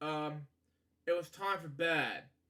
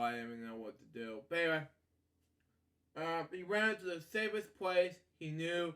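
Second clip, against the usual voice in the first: plain reading voice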